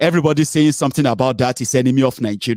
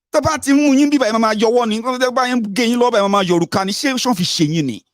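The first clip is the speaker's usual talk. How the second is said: heated scolding; 9 semitones higher, faster than usual. Real, not acted. Scolding his foolish listeners.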